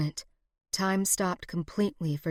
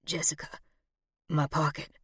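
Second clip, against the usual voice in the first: low, faint, halting voice; weakly dying; 4 semitones lower, 5 dB quieter than usual. A narrator's reading. Wounded man to Jessica, low and faint. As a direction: speak softly with failing strength.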